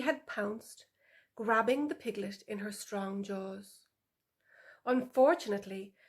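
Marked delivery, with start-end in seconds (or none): none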